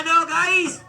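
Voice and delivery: ordinary delivery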